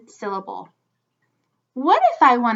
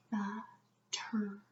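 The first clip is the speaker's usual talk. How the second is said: slow whisper, Butterfly in three separate parts; whispered syllables; 3 semitones lower, 18 dB quieter, slower than usual. Shown as a demonstration. Teacher checking the syllable count, whispered slowly. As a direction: whisper but, ter, fly with gaps.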